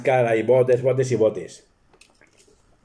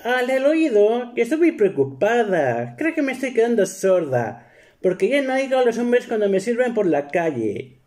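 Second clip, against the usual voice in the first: much higher wife voice; worried wife; 10 semitones higher than usual. Retold or imitated. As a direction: play her fretting over her hearing. (esposa preocupada en voz muy aguda)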